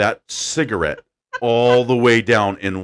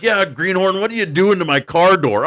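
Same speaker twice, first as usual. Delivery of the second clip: razzing; 9 semitones higher, 3 dB louder than usual. Retold or imitated. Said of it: "And I was like".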